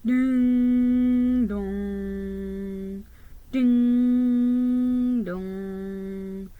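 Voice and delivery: steady drawn-out bell tones; sung midnight clock chimes; chiming ding dong. A narrator's reading. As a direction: toll each chime long and even.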